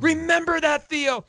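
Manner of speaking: a hint of desperate urgency; urgent parting plea. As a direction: call it back to him as he's dragged off. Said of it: "Doctor Gatiss is like".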